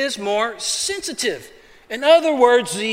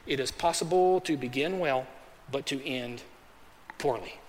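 usual speaking voice

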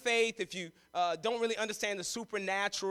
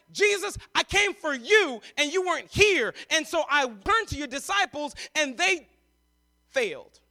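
pleading father's outcry; 5 semitones higher, 5 dB louder than usual. Acted. Father complains loudly to Jesus.

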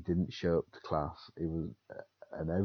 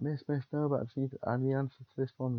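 pleading pupil; 8 semitones higher, faster than usual. Retold or imitated. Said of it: "He's like".

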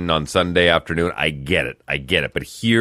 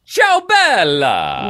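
higher, slow, loud hammed Italian accent; 12 semitones higher, 4 dB louder, much slower than usual. Host flirting in a put-on Italian accent. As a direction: croon it like an Italian charmer. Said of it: "doing an accurate Italian accent".

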